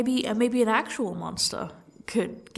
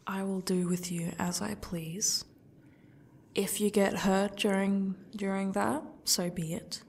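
menacing whisper. Acted. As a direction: breathe the threat, slowing down. Captor threatening captive.

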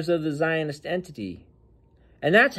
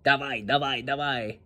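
softer mock Russian accent, trailing off; 3 semitones lower, 5 dB quieter than usual. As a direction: imitate a fighter copying Khabib in Russian. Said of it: "his best impersonation of Khabib".

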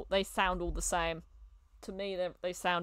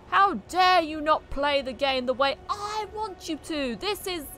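higher, louder mock indignation; mock outrage; 8 semitones higher, 5 dB louder than usual. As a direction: act outraged, hammed-up and high-pitched. Mimics a demanding, offended viewer.

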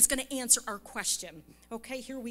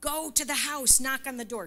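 loud, higher-pitched insistent order; stronger command; 4 semitones higher, 6 dB louder than usual. Retold or imitated. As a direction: imitate God's voice pressing harder. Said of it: "and it's stronger now".

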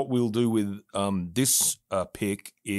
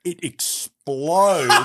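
excited; 7 semitones higher, 7 dB louder, much slower than usual. Retold or imitated. Coffee bottle bursting in the story.

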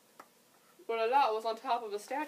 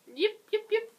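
puppy yips; 14 semitones higher, 10 dB louder, slower than usual. Acted. Lost puppy yipping.

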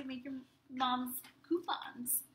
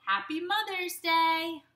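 louder, higher cheer; kids crying out; 5 semitones higher, 7 dB louder than usual. Acted. Children greeting mom with a cheer. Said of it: "we cried".

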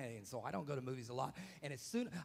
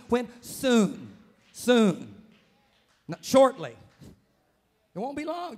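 loud, higher preaching with drawn-out Soon; full-force preaching; 7 semitones higher, 12 dB louder, slower than usual. Acted. Pastor preaching to the church about victory, loud full-force preaching. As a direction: preach it home to the congregation.

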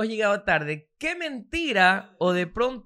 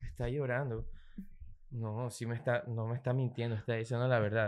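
mock sincere murmur; 9 semitones lower, 13 dB quieter than usual. Mocking a fooled boyfriend.

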